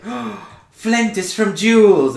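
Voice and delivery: high, loud, slow gasp of news; mock amazed discovery; surprised reading. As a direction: gasp it out in wonder. [name] reads letter aloud.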